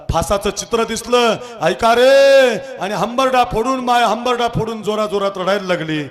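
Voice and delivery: high, loud rising sermon; fiery preaching. Acted. Preacher telling of the mother's cry, loud and high. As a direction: build to a loud climax. Preaching builds to full force.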